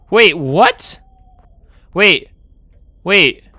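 loud startled wait; alarmed. Real, not acted. Gamer startled by a changed staircase. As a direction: call it out sharply, alarmed.

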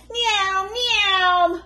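high, loud cat meow; a child playing at being a cat; playful meow. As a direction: meow like a kitten. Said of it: "went Baby Bear".

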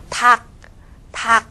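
닭 pronounced as 닥, ㄹ left silent; right-side consonant. Shown as a demonstration. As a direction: say 닭 with only the final k sound. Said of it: "我们要读右边的". (닭只读右边的ㄱ收音)

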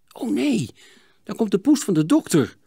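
high-pitched alarmed cry; startled. Acted. Hielke spots the doctor's cat, startled. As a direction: react with sudden alarm. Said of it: "schrok Hielke".

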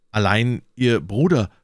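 tentative inquiry with a touch of shyness; timid question. A narrator's reading. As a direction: ask carefully, lingering on "Bruder". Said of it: "fragte D'Artagnan schüchtern".

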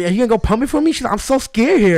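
high, mock-frightened girly voice; mock scared girl. Coworker's fear mocked to friend, high and scared. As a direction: imitate her, squeaky and scared.

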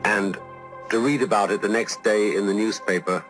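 ordinary talk

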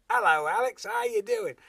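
high, very loud mock working-class British accent; wrong-class accent. Stuntman repeats his doctor's greeting to Alex, loud working-class accent. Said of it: "the guy does it again".

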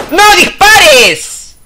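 high, drawn-out slow yell; urgent yell. Real, not acted. Player yelling at a soldier not to shoot, urgent high yell. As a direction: stretch the shout out.

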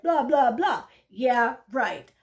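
loud, dismissive sarcasm; scornful. Acted. Pigeon dismissing the duck's questions, scornful. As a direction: scoff loudly, brushing it off.

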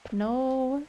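ordinary talk

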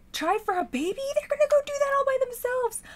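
high, loud, incredulous yell; shocked outburst. Real, not acted. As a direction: shriek it in scandalised disbelief. Shocked at sims' unprompted action.

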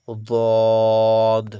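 বদ very loud with a long rounded vowel; rounded, drawn-out. Shown as a demonstration. Teacher models for students, বদ rounded and stretched. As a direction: round the mouth and hold বদ. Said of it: "মুখগুলো কিন্তু গোল হয়ে যাবে".

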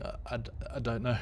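ordinary talk, nothing marked

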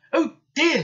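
high, dismayed exclamation; loud little-engine dismay; worried. A narrator's reading. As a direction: wail it in alarm. Bill dreads being blamed.

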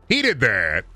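ordinary delivery

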